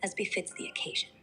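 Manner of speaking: put-on refined British accent; genteel upper-class English accent; posh Queen's English. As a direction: speak the Queen's English, very proper. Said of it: "Give me the posh style Queen's English now".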